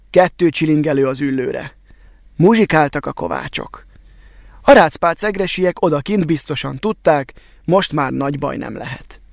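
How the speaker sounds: plain reading voice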